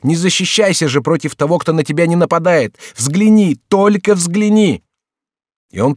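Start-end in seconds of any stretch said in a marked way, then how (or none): 0.0-4.8 high, urgent, climbing plea; shout, rising to a desperate peak; frantic raised-voice appeal. A narrator's reading. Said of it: "закричал Генрих".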